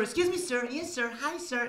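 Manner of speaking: imitating polite English "sir"; lightly voiced courteous Englishman; mock polite Brit. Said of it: "they always call you sir".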